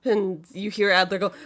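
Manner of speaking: ordinary talk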